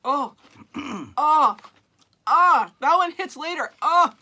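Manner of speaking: loud gasping oh from the burn; wasabi burn. Real, not acted. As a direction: cry out as the heat hits. Wasabi hitting later.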